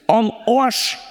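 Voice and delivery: "am Arsch" in broad Bavarian, a rising "oasch"; Bavarian accent. Shown as a demonstration. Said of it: "sag's mal auf Bayerisch". ("am Arsch" auf Bayerisch)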